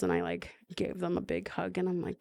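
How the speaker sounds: ordinary delivery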